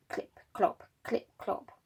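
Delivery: soft whispered clip clop; hushed rhythmic hoof sounds for children; whispered hoofbeats. Acted. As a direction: tap out the donkey's steps in a whisper. Donkey hoof sounds in story.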